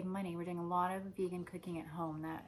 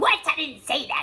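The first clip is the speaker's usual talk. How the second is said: indignant, high, loud mock outburst; defensive; 8 semitones higher, 16 dB louder than usual. Someone with metal poisoning denying their words, defensive. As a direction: blurt out a loud, offended denial. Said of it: "You're defensive, and you're like".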